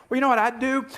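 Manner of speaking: usual speaking voice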